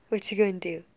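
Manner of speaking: usual speaking voice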